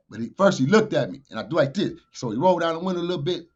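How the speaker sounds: ordinary delivery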